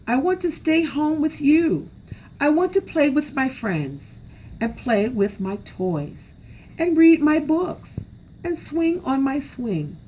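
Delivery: put-on high, pleading child's whine; crying child. A narrator's reading. Small raccoon crying to his mother, high whiny plea. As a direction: beg not to go, near tears. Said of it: "and cried".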